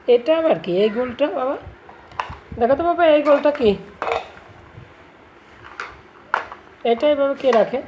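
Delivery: to someone hard of hearing. Real, not acted. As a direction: call out loudly to make her hear. Mother raising voice to distracted child.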